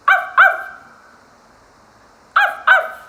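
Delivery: high, loud yappy barking; dog barks. Shown as a demonstration. Teacher makes animal sounds for kiddos, high yapping. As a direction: yap sharply like a small dog. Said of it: "I'm going to make animal sounds".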